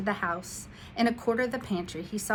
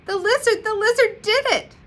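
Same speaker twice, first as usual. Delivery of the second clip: much higher, louder joyful shout; overjoyed; 11 dB louder than usual. A narrator's reading. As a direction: burst out with delight. Joy at the magic.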